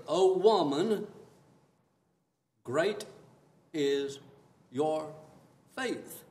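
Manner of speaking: each word spaced out, slow and loud; Jesus solemnly praising the woman's faith, slow; solemn proclamation. Acted. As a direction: pronounce great is your faith word by word.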